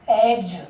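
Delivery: "एग्ज" ending in a voiced j; final J sound. Shown as a demonstration. Teacher asking how eggs is said, final J sound. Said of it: "क्या बोलेंगे इसको?".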